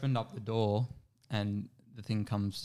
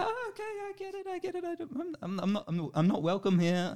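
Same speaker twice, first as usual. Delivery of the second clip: much higher, settling down as you back away; nervous high retreat. Retold or imitated. Backpedaling to the gate from a biting dog, nervous high voice. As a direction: squeak it out nervously, then calm down.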